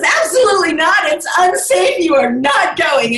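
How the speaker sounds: stern father refusing. Retold or imitated. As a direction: play the dad putting his foot down. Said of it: "her dad says".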